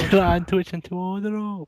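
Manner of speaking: put-on high, slow accent, "To" slurred lightly; silly accent gliding over "To". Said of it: "It's more emphasis on the tit".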